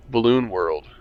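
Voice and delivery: ordinary talk, nothing marked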